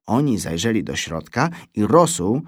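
ordinary delivery, nothing marked

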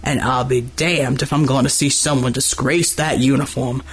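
understated anger in a deep big-man voice; low snarl. A narrator's reading. Dockworker towering over seated military man, restrained low snarl. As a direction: growl it low, anger held down. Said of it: "Gordy's last words came in a snarl".

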